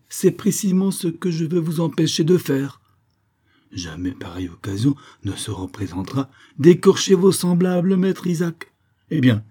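high, forceful reprimand; stern rebuke. A narrator's reading. Governor forbids the merchant to fleece others, stern rebuke.

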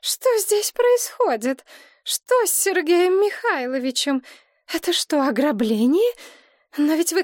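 high, anguished pleading; pained, high-voiced appeal; full of suffering. A narrator's reading. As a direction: plead in distress, pitch held high. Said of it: "проговорила полным страдания голосом".

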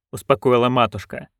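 usual speaking voice

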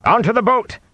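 high urgent shout. A narrator's reading. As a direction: shout an order in alarm, pitch way up. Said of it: "Tom shouted".

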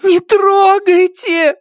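slow, high crying plea; tearful pleading. Acted. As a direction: beg through tears. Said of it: "умоляла Ада, размазывая по лицу слёзы".

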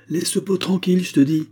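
agitated, threatening command; angry growled warning. A narrator's reading. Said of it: "grogna Dix en saisissant le tisonnier".

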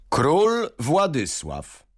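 high, slow, drawn-out announcement; servant announcing. A narrator's reading. Servant at the door announcing the king, slow call. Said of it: "zawołał sługa".

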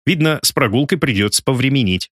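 ordinary delivery

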